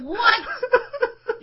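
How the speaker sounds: disbelieving squeal through laughter; a high laughing shriek of disbelief; high squeaky what. Retold or imitated. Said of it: "I was like".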